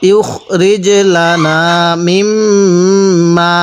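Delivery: ইউখরিজ লানা মিম্মা read very slowly, ঘুন্না held on মিম্মা; slow chanted Arabic for pupils; slow tajweed recitation. Shown as a demonstration. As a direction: recite each syllable stretched like a teacher modelling. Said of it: "এরকম করে পড়ব".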